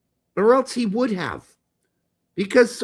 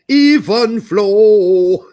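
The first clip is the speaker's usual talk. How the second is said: German accent; 10 dB louder, much slower than usual. Shown as a demonstration. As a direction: sing it with a heavy German accent. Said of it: "with a German accent".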